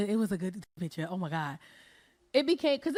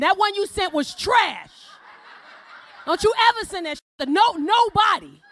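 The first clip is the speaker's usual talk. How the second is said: mock shouting man; 4 semitones higher, 3 dB louder than usual. Man texting back a scolding about the bad picture, loud. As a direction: bellow it like a scolding friend. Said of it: "He gonna text back".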